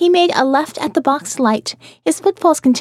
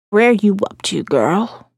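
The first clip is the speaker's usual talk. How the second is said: lightly voiced croaking old woman; a hint of the Goonies mama, low and rough; old lady gangster; 3 semitones lower, slower than usual. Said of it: "she sounded like the old lady gangster in the Goonies".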